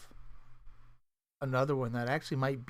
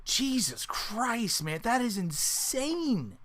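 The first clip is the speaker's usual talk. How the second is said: disbelief; 8 semitones higher, much slower than usual. Real, not acted. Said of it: "What is time?".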